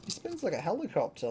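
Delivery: ordinary talk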